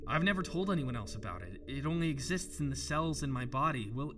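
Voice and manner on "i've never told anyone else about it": ordinary delivery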